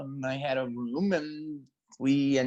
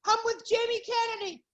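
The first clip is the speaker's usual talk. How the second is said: put-on girlfriend's confession. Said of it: "and she goes".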